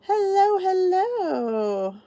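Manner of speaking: high, drawn-out stream hello; greeting viewers as they join, singsong and high. Real, not acted. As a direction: sing the welcome to new viewers. Greets new viewers joining stream.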